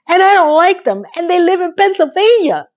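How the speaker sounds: imitating Miranda. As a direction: pitch it up like a sulky confession. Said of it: "She goes".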